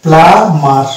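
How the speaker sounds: প্লামার said with no b sound; model pronunciation. Shown as a demonstration. Teacher giving the actual pronunciation, carefully. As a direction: say প্লামার, smooth m into a, b silent. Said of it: "actual pronunciation হবে".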